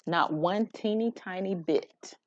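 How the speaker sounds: usual speaking voice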